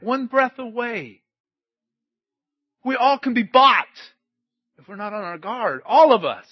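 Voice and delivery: forceful preaching. Real, not acted. Preacher warns the church to be on guard, preaching forcefully. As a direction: drive each warning home to the congregation.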